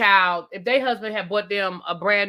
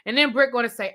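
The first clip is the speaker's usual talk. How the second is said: normal talking voice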